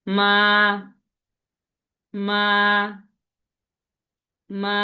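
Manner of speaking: each "মা" drawn out at an even pitch; flat first tone. Shown as a demonstration. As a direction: hold "মা" level, with no break. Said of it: "flat ভাবে যাবে". ("মা" সমান্তরাল সুরে টেনে বলা)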